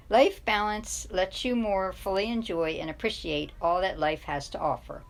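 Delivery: plain reading voice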